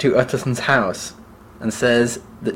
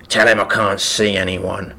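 complaining; 3 semitones lower than usual. Acted. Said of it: "it said complainingly".

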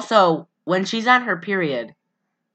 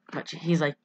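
normal talking voice

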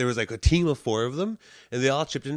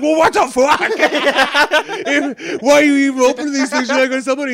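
high, loud incredulous yelling; hammed-up exasperated shouting; mock outrage; 13 semitones higher, 12 dB louder, faster than usual. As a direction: imitate a girlfriend exploding. Acted outraged girlfriend quote.

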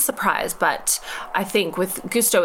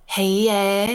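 quick Geordie-tinged "hey, yeah"; a cheeky Geordie pop star greeting; light Geordie accent impression. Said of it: "all you have to do for that is be like".

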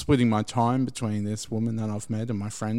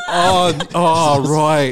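loud, higher laughing shout; laughing realisation; 5 semitones higher, 10 dB louder, much slower than usual. Real, not acted. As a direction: it all clicks at once, laughing. Laughing at his own realisation.

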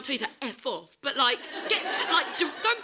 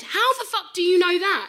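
loud, high-pitched outraged demand; livid; 8 semitones higher, 6 dB louder than usual. Retold or imitated. Retelling her comeback to the hat man, livid. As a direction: fire it back, indignant and incredulous. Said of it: "I was so livid".

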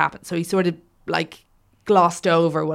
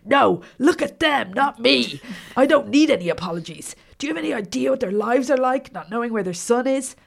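raised, high, impassioned courtroom tirade; angry outburst; 7 semitones higher, 6 dB louder than usual. A narrator's reading. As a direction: berate the witness loudly, voice climbing. Lawyer berating witness, narrator acting.

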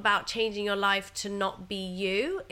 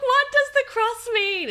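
much higher, much louder frantic yell; shouting at sister and partner in panic; panicked shout; 8 dB louder than usual. Retold or imitated. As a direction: yell it through the bathroom door in panic. Repeats after silence, louder.

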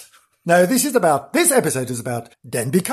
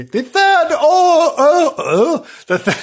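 tongue-tied; 13 semitones higher, 5 dB louder, slower than usual. Real, not acted. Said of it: "Why can't I say that?".

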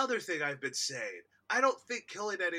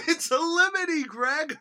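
high, loud quoted line; quoting a character; 10 semitones higher, 6 dB louder than usual. Retold or imitated. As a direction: belt it out. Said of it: "I've been saying".

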